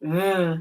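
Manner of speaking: Uh as a sustained buzzing vvv; isolated final sound. Shown as a demonstration. Teacher isolates the end of dove, Uh as vvv. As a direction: say Uh as a pure v sound. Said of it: "What's the last sound".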